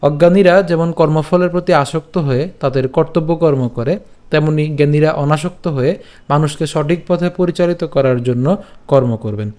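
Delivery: ordinary talk, nothing marked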